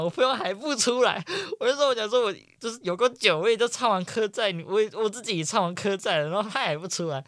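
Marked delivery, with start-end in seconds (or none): none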